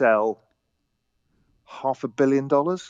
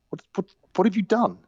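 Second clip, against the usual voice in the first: higher stammered what, what, what; hearing the fifty-eight percent return, stammering in higher disbelief; stunned disbelief; 8 semitones higher than usual. Retold or imitated. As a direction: sputter it out in shock.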